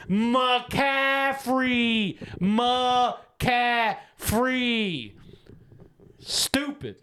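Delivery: high, drawn-out McCaffrey in disbelief; exasperated emphasis. Real, not acted. Host repeating McCaffrey to his co-host, exasperated and high. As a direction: lean on McCaffrey like you can't believe it. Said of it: "let me say it again".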